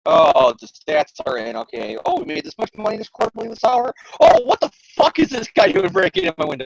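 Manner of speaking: lightly voiced bored office worker humming; a hint of Harry muttering through paperwork; mock daydreaming executive, then alarmed. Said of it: "Harry's just sitting at the office doing".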